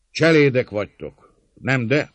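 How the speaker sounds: loud, high calling voice; shouted challenge. A narrator's reading. Attacker addressing servants at the gate, calling loudly. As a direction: throw the question out loudly.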